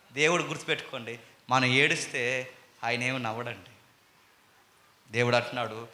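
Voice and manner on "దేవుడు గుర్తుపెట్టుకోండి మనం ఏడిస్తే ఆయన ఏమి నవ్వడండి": ordinary talk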